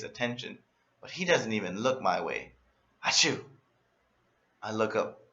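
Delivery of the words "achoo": theatrical pretend achoo; overacted sneeze. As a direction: fake a big sneeze to get attention. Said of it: "I guess I overacted my sneeze".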